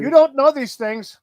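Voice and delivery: echoing in a put-on English accent; mock English accent. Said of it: "You're good at that English accent".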